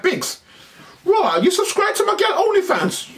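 outraged high call-out; mock angry shout. Angry man confronting.

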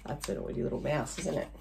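ordinary delivery

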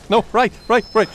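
high loud urgent directions; frantic calling. Real, not acted. Player shouts directions while fleeing the monster, frantic. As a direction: call the way out in a panic.

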